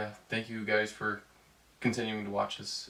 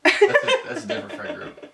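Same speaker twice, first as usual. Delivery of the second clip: much higher, laughing through the words; 11 dB louder than usual. Real, not acted. Joking about her friends, breaking into laughter. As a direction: crack up mid-sentence. Laughing at his own joke.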